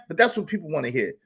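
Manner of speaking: ordinary talk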